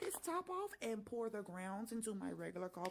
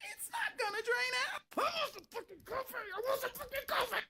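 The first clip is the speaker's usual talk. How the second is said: much higher, louder, desperate craving; frustrated whine; 13 semitones higher, 4 dB louder than usual. Real, not acted. Complaining to viewers about the missing lid, frustrated high whine. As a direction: whine it at the top of the voice. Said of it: "I'm stressing out".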